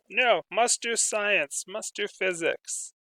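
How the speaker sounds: high, slow, mechanical chant of duty; robotic determination. Pretend. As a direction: drone it like a dutiful robot. Robotic self-scolding resolve.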